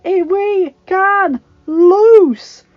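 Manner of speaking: high, very slow, stretched yell; loud, drawn-out command; defiant battle shout. Acted. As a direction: roar the order word by word. Lord shouts defiant order.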